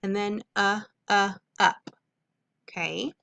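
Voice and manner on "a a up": teacher modeling short u; short u sound. Shown as a demonstration. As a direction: isolate the short u, a, a, then say up.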